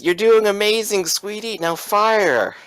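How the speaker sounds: high, mock-sweet encouragement. Game master as Thomas while you struggle, mocking. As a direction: coo praise mockingly, then give the order. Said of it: "That's very mocking".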